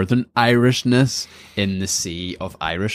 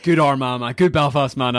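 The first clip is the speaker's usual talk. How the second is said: higher-pitched mock endorsement; imitated hearty approval; 6 semitones higher than usual. Host imitating guest's praise of northerners, mock hearty approval. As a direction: mimic someone proudly approving a fellow northerner.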